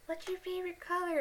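high-pitched imitation of a girl; girl from a meme. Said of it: "the girl's like".

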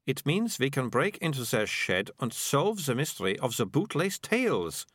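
put-on high, eager girl's voice; keen girl, drawing out the ending; adventurous Melicia. Narrator plays Melicia proposing adventure.

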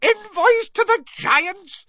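slow, very high loud shriek; shrill, incredulous outburst. A narrator's reading. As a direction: shriek it back in disbelief. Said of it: "Fudge shrieked".

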